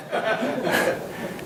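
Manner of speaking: a quick airy chuckle; soft breathy laugh. Real, not acted. Speaker laughing at John's fear of statistics, airy chuckle. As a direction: laugh softly under the breath.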